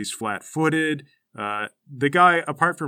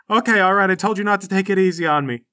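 raised, brisk, lightly voiced boy; scrappy kid; 5 semitones higher, 4 dB louder, faster than usual. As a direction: bounce back quickly with a challenge. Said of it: "he gets to his feet".

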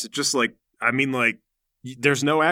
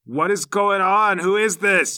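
high, slow, emphatic demand; outraged mom; 5 semitones higher, 4 dB louder, slower than usual. Acted. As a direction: demand answers like a mother finding her son pinned. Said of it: "His mom comes out and she goes".

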